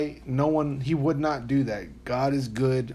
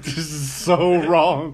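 slow mock horror; 3 semitones higher, 6 dB louder, much slower than usual. Imitating shocked listener.

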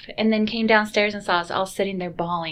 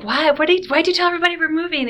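much higher, louder, fast upset demand; upset accusation; 9 semitones higher, 6 dB louder, faster than usual. Retold or imitated. Family confronting Adriano over the rumor, upset and fast. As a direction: confront him for spreading the news.

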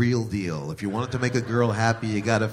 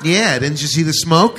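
mock sarcastic girl; 4 semitones higher, 7 dB louder than usual. Voicing the girl in bit.

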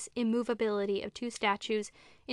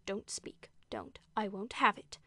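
hushed, much softer plea; awful whisper; 4 dB quieter, slower than usual. A narrator's reading. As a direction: forbid it under the breath. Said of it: "in an awful whisper".

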